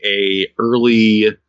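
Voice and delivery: normal talking voice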